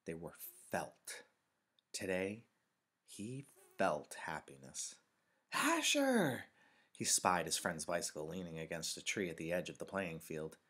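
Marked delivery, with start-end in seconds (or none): 5.5-6.4 high, loud call to a friend; high-pitched, carrying shout. A narrator's reading. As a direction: holler the name across a field. Calling out to find friend.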